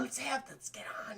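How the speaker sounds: flirty whisper. Retold or imitated.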